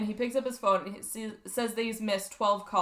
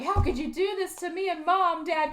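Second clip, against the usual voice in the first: hurt daughter; 6 semitones higher than usual. Retold or imitated. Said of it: "be like".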